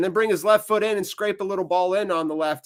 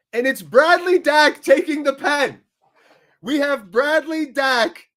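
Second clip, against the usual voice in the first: pitched high and louder, slowing to savour the moment; excited penalty call; 8 semitones higher, 4 dB louder than usual. Real, not acted. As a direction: announce the penalty like a thrilled commentator. Fan calls a penalty awarded.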